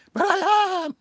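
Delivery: very high, loud mock wail; frightened Scream Snail. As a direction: squeal it as a scared snail. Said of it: "Scream Snail".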